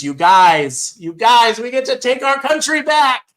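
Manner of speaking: rising to a high, loud, thrilled cry; ecstatic cheer. Real, not acted. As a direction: call out to the viewers in pure joy. Cheering Trump's lead to viewers.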